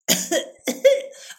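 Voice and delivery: high, exaggerated little coughs; mock coughing fit. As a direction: act out a silly coughing fit. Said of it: "I coughed for, like, an hour".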